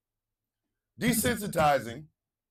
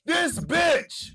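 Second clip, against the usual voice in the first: high shouted exasperated echo; 11 semitones higher than usual. Retold or imitated. Host echoing the clip in a high exasperated shout.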